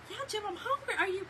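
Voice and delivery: high, loud mock outrage; retelling her reply to her husband, squeaky and indignant; indignant retort. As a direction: fire it back at him, half joking.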